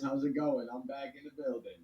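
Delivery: ordinary talk, nothing marked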